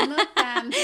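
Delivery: bursting into giggles, brushing off the compliment; laughing. Real, not acted. Compared to Sofía Vergara, laughs.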